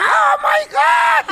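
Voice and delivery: a very high, slow shriek of surprise; startled English shout. Retold or imitated. Gringo boss shrieking at the buried treasure, high stretched shout. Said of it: "cuando grita el gabacho".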